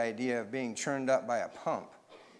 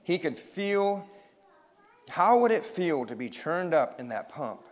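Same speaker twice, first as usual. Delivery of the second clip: usual speaking voice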